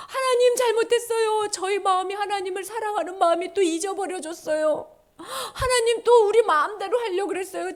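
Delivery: high, acted tearful-style confession; pleading repentance. People repenting to God for selfishness, pleading confession.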